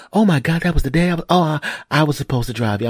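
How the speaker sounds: imitated dismayed wife. Voices wife realizing she forgot.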